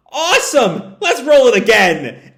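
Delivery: high, loud and laughing thrill. Retold or imitated. Tangle cheering after surviving the rapids, high laughing whoop. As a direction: cheer it like a roller coaster rider.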